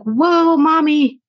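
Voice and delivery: put-on high, excited girl's voice gushing at mom; daughters' excited voice. Daughters gushing to mom after her keynote, high and excited. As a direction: squeal it like a proud kid. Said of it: "they were like".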